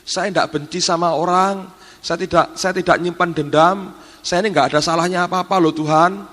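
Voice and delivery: imitating frightened servant. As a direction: plead your case to God like a scared maid. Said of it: "Dia ngomong gini".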